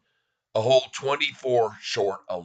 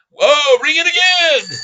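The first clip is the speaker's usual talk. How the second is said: excited shout; 13 semitones higher, 8 dB louder than usual. Real, not acted. Price jumps on live stream.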